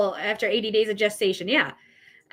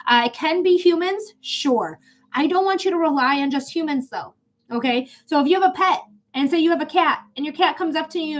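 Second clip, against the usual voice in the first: ordinary talk, nothing marked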